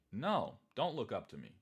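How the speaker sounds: usual speaking voice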